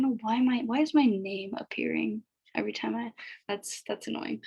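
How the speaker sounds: annoyed whisper to herself; half-whispered grumble at the screen; whispered aside. Real, not acted. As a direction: mutter it under your breath, irritated. Annoyed aside while recording.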